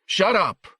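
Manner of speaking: high, sudden angry burst; angry interruption. A narrator's reading. Husband cutting off his wife mid-protest, angry. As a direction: cut them off hard. Father cuts mother off.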